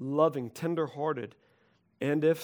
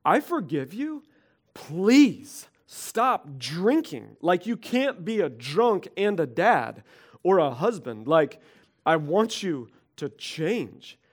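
pleading wife; 5 dB louder, slower than usual. Retold or imitated. Laura answers her drinking husband, slow and pleading. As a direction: beg him to stop, stretched and intense. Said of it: "She'd be like".

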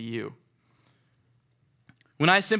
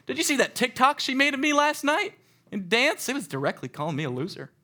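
higher, whiny excuse; mock self-justifying; 9 semitones higher than usual. Preacher mimicking someone justifying meanness, whiny. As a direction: play the petty grudge-holder.

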